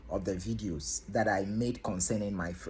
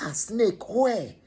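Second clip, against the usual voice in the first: high, sudden alarm at the word snake; alarmed question; 9 semitones higher, 3 dB louder, slower than usual. Retold or imitated. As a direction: react in shock. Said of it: "I was like".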